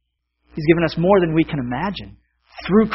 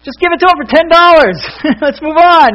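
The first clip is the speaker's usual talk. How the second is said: loud, very high impatient yell; exasperated crowd shout; 14 semitones higher, 10 dB louder than usual. Acted. Whole crowd urges the auctioneer to finish, exasperated shouting. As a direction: shout it out, fed up with waiting. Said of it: "everybody's just like".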